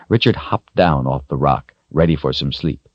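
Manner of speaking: plain reading voice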